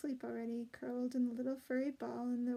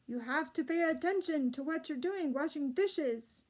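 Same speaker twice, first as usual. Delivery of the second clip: a sharp scolding from a grumpy cook, a bit louder; cross scolding cook; 4 semitones higher, 5 dB louder than usual. A narrator's reading. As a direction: scold the clumsy kitchen maid. Scolding cook voiced in story.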